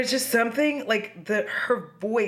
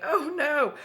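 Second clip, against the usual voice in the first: higher-pitched mock "oh no"; mock dismay; 8 semitones higher than usual. Juliet waking to find Romeo dead, imitated dismay.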